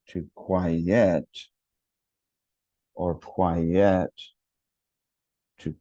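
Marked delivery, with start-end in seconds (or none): 0.3-1.3 "quiet" split clearly into qui-ET; two-syllable QUIET. Shown as a demonstration. Teacher contrasts two confusable test words, two-syllable "quiet". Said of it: "has two syllables". 3.1-4.1 "quiet" said kwai-yet, second vowel fully sounded; two-syllable QUIET. Shown as a demonstration. Teacher swaps the confusable words round, two-syllable "quiet". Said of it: "has two syllables".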